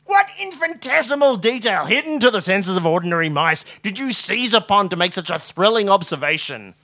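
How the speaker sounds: high, loud, overwrought astonishment; joyful amazement. A narrator's reading. Watson begs Holmes to explain, hysterically amazed. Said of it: "crying tears of joy and amazement".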